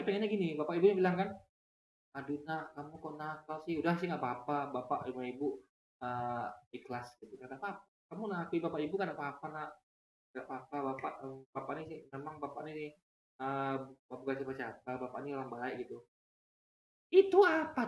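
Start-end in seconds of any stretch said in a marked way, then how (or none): 2.2-7.1 much softer, gentle giving-in; soft indulgent parent. Retold or imitated. Lax parent softly excusing the naughty child. 7.6-15.9 much softer, gentle giving-in; soft indulgent parent. Retold or imitated. Lax parent softly excusing the naughty child.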